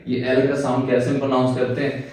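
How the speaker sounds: ordinary talk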